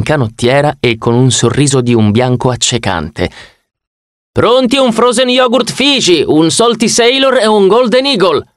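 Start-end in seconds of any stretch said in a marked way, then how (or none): 4.3-8.5 high, bright counter announcement; order call-out. Acted. The waiter announcing the drinks from the counter, high-pitched. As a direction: call the order over the noise.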